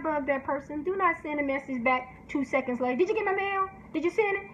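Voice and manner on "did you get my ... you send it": pushy author. Retold or imitated.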